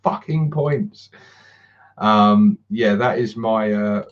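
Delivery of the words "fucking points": mock exasperation. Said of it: "And I'll be saying".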